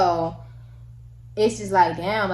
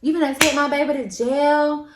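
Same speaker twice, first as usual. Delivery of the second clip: a raised, higher, indignant mother; mom's outrage; 6 semitones higher, 5 dB louder than usual. Retold or imitated. Mom confronting the officer, loudly indignant. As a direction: demand it like a mother defending her kid. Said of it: "my mom was like".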